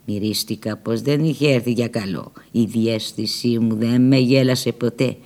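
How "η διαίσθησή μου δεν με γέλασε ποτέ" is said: ordinary delivery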